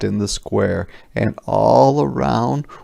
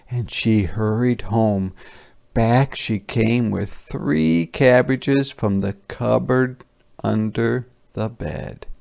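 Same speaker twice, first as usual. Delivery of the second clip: plain reading voice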